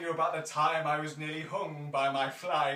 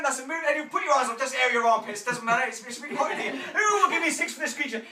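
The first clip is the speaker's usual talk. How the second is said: loud, high auctioneer calling bids; booming auctioneer calling for bids; auctioneer patter; 5 semitones higher, 8 dB louder than usual. Acted. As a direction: drive the bidding up, big and fast.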